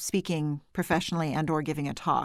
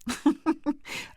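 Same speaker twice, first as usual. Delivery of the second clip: laughing; 9 semitones higher than usual. Real, not acted. Self-conscious laugh.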